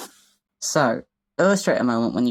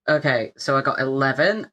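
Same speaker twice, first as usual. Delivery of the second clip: ordinary talk, nothing marked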